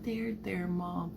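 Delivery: put-on high, very soft child voice; comforting little boy. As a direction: soothe mom like a small son. Voices son comforting mother.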